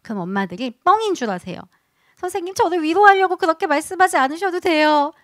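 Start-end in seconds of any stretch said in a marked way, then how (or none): 2.2-5.1 higher, louder imitation of doubting moms; imitated mom's high-pitched protest; mothers politely protesting. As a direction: put on a higher voice of mothers declining comfort. Acts out mothers' disbelieving reply.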